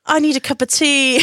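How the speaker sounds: high, hammed-up plea for tea; acted quote. Acting out a scene line.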